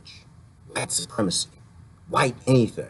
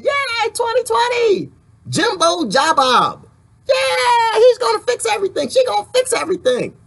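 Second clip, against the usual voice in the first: mock cheering. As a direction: cheer like a gullible fan, dripping with sarcasm. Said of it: "you mad at me that I'm not cheering?".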